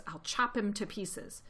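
ordinary delivery

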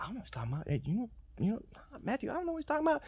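whispered confused disciples, very soft; mock hushed chatter at the back of the room. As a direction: lean in and murmur like a worried disciple. Disciples whispering among themselves.

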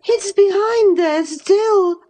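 worried it is still hiding there; anxious. A narrator's reading. Catherine frets to Nelly about the mirror, anxious. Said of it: "she pursued anxiously".

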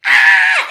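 short, loud acted scream; imitated scream in mid-story; retold scream. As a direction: let out a burst of a yell. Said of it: "I started screaming".

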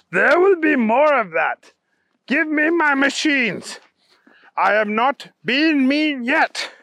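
high, loud heroic bunny voice; Kyle, stern threat. Acted. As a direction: demand it back with force.

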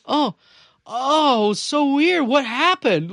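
high, loud, badly faked astonishment; feigned surprise. Boys who broke the door answer dad, faking surprise. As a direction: play innocent, overdoing the puzzlement.

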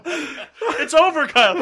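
slow, laughing teasing; drawn out through laughter. Real, not acted. Laughing at friend's wrong guess.

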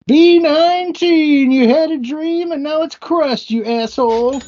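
high, loud sing-song bingo call; bingo caller. Acted. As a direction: shout the number like a bingo caller. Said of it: "This might be my style".

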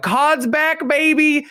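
high, slow hammed-up hype shout; imitating hyped YouTubers; mock creator hype, pitched high. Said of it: "they're like".

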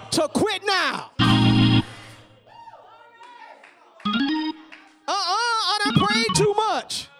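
building from steady to shouting climax; rising preacher cadence. Acted. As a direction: climb to a full-force preached peak. Sermon climbing to a climax.

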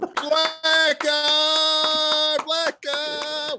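excited call. Pretend. Echoing the mock call.

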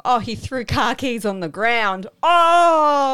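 high, loud mock-scandalised gasp; mock outrage. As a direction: imitate people making a huge drama of it. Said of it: "people are clinging onto it going".

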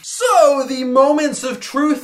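high, showy game-show host; mock host voice. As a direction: announce it like a TV presenter, hamming it up. Hosts a mock results segment.

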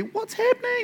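high-pitched, baffled outburst; mock bewilderment. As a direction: squeak it out in playful disbelief. Acted reader's shocked reaction.

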